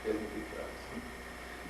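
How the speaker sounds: whispered, drawn-out mock confiding; under the breath, imitating her; hushed retold aside. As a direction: whisper it like gossip. Acting out a woman's whispered aside.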